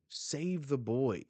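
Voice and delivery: lightly acted indignant cry; mock outraged order. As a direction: order it urgently, stretching the words. Reads an alarmed character's rebuke.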